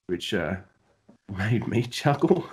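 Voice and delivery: ordinary talk, nothing marked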